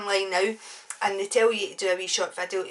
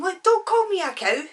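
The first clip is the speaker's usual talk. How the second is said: higher, louder indignant warning; firm warning; 10 semitones higher, 5 dB louder than usual. Retold or imitated. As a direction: re-enact laying down the law. Acted warning in the quarrel.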